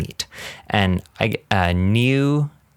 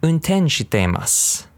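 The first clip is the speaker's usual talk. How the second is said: Unten shiteimasu at a quicker, natural pace; a little faster; 8 semitones higher than usual. Shown as a demonstration. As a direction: speed Unten shiteimasu up a touch. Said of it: "Say a little faster".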